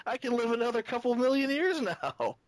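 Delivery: high, hammed-up old master voice; mock Yoketron. A podcaster jokingly voices Yoketron thanking Prowl, hammed-up and high. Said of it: "Yoketron didn't get up and say".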